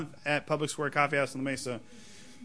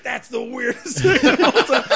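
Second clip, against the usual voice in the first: higher and louder, laughing through the words; laughing in disbelief; 9 semitones higher, 7 dB louder than usual. Retold or imitated. Comic laughing in disbelief as he tells the podcast hosts. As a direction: crack up at how absurd it was. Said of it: "And I was like".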